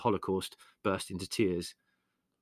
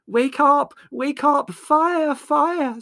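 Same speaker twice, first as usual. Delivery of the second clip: very high, full-voiced alarm; screaming; 11 dB louder, much slower than usual. A narrator's reading. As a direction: scream at the top of your voice to wake the house. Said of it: "screamed Margot at the top of her voice".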